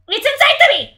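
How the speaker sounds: high, loud yell of disbelief; incredulous outburst. Real, not acted. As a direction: explode in outraged disbelief. Incredulous outburst repeating the line.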